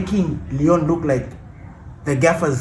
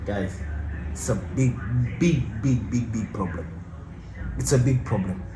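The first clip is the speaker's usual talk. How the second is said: dropped low and soft, dragging out each big; hushed and grave; 8 semitones lower, 9 dB quieter than usual. Real, not acted. Vlogger warning fellow fans, hushed and grave. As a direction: lean in and confide a big worry quietly.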